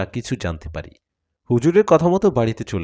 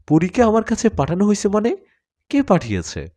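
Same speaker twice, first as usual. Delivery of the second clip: shocked question; 10 semitones higher, 3 dB louder than usual. A narrator's reading.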